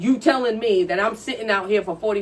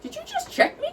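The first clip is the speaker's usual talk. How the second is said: much higher, squeaky retold disbelief; mock outrage at being checked; imitating her own shocked self; indignant disbelief; 5 dB quieter than usual. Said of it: "And I was like".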